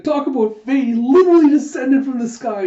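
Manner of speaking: high and loud, bursting with joy; starry-eyed thrilled voice; giddy excitement. Acted. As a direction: gush it out. Gita overjoyed.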